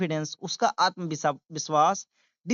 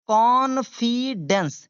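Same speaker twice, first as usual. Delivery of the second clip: confidence very slowly, "con... fi... dence", louder start; syllable-by-syllable model; 3 semitones higher, 4 dB louder, much slower than usual. Shown as a demonstration. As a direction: stretch confidence out in three separate beats. Teacher breaks word into syllables. (confidence धीरे-धीरे, टुकड़ों में)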